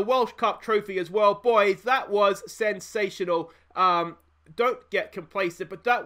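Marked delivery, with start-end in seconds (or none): none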